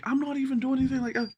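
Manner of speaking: hurried high-voiced defense; protesting innocence. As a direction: plead your case in a squeaky rush. Acts his protest to boss.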